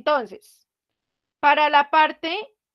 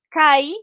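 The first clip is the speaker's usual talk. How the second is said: kai said alone and louder as a single beat; one syllable; 2 semitones higher, 5 dB louder than usual. Shown as a demonstration. Teacher isolating kai to show it is one syllable. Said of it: "es una sola sílaba".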